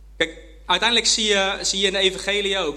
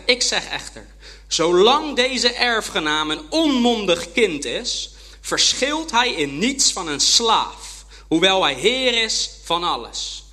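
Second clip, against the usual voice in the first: louder, slow weighty reading of Paul; slow scripture reading; 2 semitones higher, 5 dB louder, slower than usual. Acted. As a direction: weigh each word of the letter. Reads Paul's letter slowly. (langzaam en plechtig voorgelezen)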